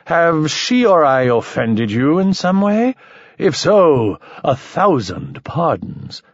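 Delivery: high, hammed-up tipsy courtesy; mock drunk apology. A narrator's reading. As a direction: overplay the drunk's politeness. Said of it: "slurred Mike".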